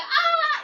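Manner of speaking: sudden high, loud shriek of pain; shark scream. Pretend. The shark shrieks as its fin is chopped off, high and loud. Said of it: "and the shark is like".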